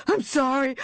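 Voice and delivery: high, shouted apology, leaning on I'm; panicked shout. Acted. Buried man begs forgiveness.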